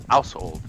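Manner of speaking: put-on Household with the old vowel; original pronunciation. Shown as a demonstration. Repeating for a friend, old Household. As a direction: Household said the Shakespearean way. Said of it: "would be household".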